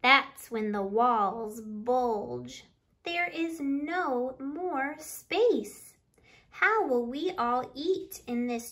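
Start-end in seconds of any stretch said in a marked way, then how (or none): none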